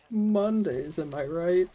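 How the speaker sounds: very high, hammed-up comic quip; sitcom punchline. Dying zombie quipping to camera, high hammed-up voice. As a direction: toss a cheesy catchphrase out high. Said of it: "and it's like".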